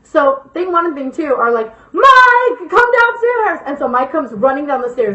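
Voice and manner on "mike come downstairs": high, loud yell to someone upstairs; calling out. Retold or imitated. Friends yell for Mike from downstairs, calling out. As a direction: holler up the stairs, high-pitched.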